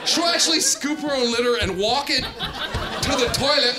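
ordinary talk, nothing marked

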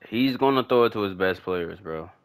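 ordinary talk, nothing marked

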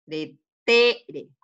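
"Pretere" with TE held and loud; stress on middle syllable. Shown as a demonstration. Teacher confirms the middle one, "Pretere" with punched TE. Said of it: "en la del medio".

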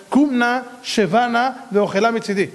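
Jacob. Acted. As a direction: ask courteously, word group by word group. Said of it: "איך יעקב מדבר". (בקשה מנומסת בקולו של יעקב)

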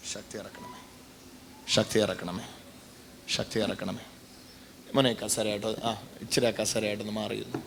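soft murmured healing prayer, low; hushed prayer. Acted. Preacher prays softly over the man's weak leg. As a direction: pray quietly over the sick leg.

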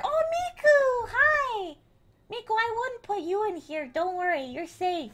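high, soft, doting voice; affectionate cooing. Real, not acted. Streamer greets Miku and says they are safe, cooing. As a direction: coo it gently to soothe a little one.

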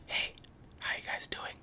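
soft unvoiced whisper close to the mic; ASMR whisper. Shown as a demonstration. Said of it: "How is it for A S M R?".

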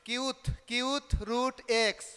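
কিউথ, কিউথ রুট x said slowly, each word drawn out and separate; slow spelled-out reading. Shown as a demonstration. Teacher reading the board term slowly to students, slow and deliberate. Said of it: "লেখছি যে".

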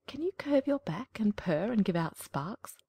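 slow, whispered cat voice; sly cat. Acted. Cat quizzing the duckling in a slow whisper.

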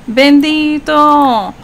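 very slow, high and loud drawn-out bendito; aww, poor thing, long and pleading; pitying sigh. Real, not acted. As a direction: stretch the word out in heartfelt pity. Sympathy for crying Sim.

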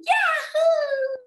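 high, loud, drawn-out whoop; whooping cheer. A narrator's reading. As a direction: finish with a big long whoop. Final cheer of the story.

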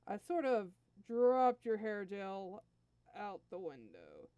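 slow, dragged-out admission; reluctant confession. Acted. Nappa confesses to the prince.

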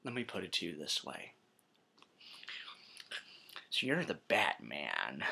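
low, quiet villain voice; Joker role-play. Acted. Joker addressing Batman during interrogation, low and quiet. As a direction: keep it low and soft, toying with him.

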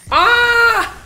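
high, loud scream of fright; sudden scream at the ambush; startled scream. Real, not acted. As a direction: shriek in real surprise. Sudden enemy attack.

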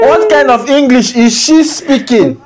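mock her loudly, disbelieving; loud mocking question. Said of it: "You have the moral high ground".

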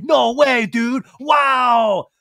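high, loud mock amazement; amazed dude impression. Guys hearing Lisa's news, hammed-up amazement. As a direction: burst out with an exaggerated wow. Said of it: "Schwartz and Sandoval's like".

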